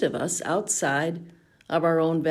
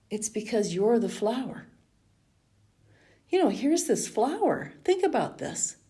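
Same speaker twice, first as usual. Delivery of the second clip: mildly choked up; 4 semitones higher than usual. Retold or imitated. Therapist telling a tearful client she is the flower, mildly choked up. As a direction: retell a tender realization, lightly choked. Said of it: "of course, I'm choked up too".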